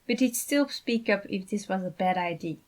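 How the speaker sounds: plain reading voice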